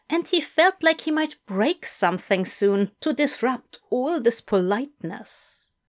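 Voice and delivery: usual speaking voice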